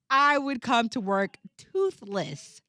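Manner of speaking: ordinary talk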